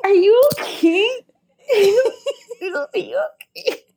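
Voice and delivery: very high, timid squeak; mock concerned friends. Friends check on her after a fight, mimicked worry. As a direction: imitate worried friends in a tiny high voice.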